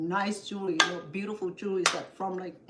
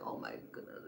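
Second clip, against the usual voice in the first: much lower, hushed and softer; awed whisper; 14 dB quieter, faster than usual. Real, not acted. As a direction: let it out in a stunned whisper. Overwhelmed that strangers returned it.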